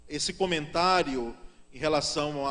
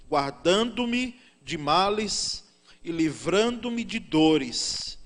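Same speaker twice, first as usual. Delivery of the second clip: scripture reading; 2 semitones lower, 4 dB louder than usual. Acted. Pastor reading the Bible to the congregation, solemn and measured. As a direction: read the verses out with weight.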